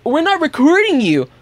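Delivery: high, mocking imitation of a drunk woman; mimicked drunk denial. Drunk woman denies filming him, high mock-innocent voice. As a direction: deny it in a high put-on voice.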